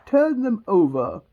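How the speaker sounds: loud, slow royal order; commanding Queen. Acted. Queen ordering the Knave, loud and imperious. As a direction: command it loudly, stretching the words. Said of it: "said to the Knave".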